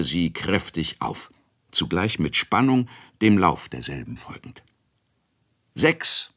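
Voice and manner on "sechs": hoch gerufene Zahl über die Bahn; Ruf des Kegeljungen. A narrator's reading. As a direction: ruf das Ergebnis laut hinüber. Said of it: "schrie der Kegeljunge". (Kegeljunge ruft laut die Zahl)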